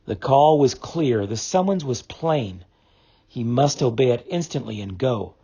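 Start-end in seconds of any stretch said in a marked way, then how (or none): none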